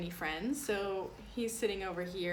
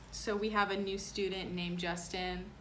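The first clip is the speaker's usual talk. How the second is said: usual speaking voice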